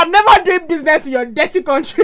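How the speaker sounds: loud, high mock outburst; Richard Branson. Imagining the airline boss quitting Nigeria, loud mock indignation. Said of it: "Richard Branson now say".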